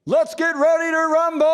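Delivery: stretched, roaring high call to the crowd; booming ring announcer. Acted. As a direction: roar it out to the whole arena. Ring announcer's famous opening call.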